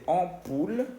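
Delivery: "ampoule" slowly as on-POOL, nasal first vowel; French pronunciation. Shown as a demonstration. Teacher translating foco, slow French "ampoule". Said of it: "que se dice".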